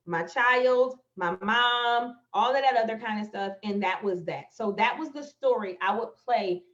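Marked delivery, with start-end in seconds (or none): none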